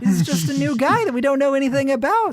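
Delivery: high, quick, chirpy put-on voice; feigned innocence. Host pretending this is just a new guy, mock-innocent. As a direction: rattle it off high, as if suspecting nothing.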